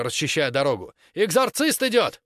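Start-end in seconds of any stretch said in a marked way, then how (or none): 1.1-2.2 high, loud announcing call. Acted. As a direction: call out over the crowd. Heron clears the crowd.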